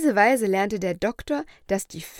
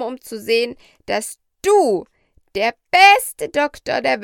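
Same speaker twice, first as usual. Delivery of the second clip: talking parrot; 4 semitones higher, 8 dB louder than usual. Acted.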